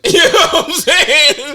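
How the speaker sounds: laughing. Real, not acted. Laughing high aside.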